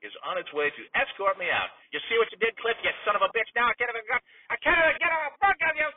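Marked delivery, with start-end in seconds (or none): 1.9-5.9 builds to a high, loud scream; fired announcer screaming at his nephew; furious screaming. Acted. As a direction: explode as security drags him off. Being escorted out, furious.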